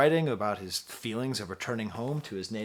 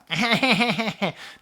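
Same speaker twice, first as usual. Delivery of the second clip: mom's laugh again; 10 semitones higher, 7 dB louder than usual. Retold or imitated. Mimics mother laughing at movies.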